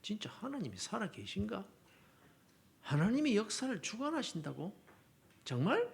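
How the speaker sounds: 목소리를 낮춘 의심 섞인 물음; 혼잣말처럼 작게 되물으며 의심하기; 회의에 찬 되물음. Acted. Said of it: "회의가 일어날 때 많더라는 거예요". (조용히 의심하며 되묻는 말투)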